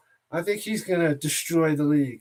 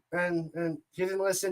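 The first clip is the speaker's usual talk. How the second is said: normal talking voice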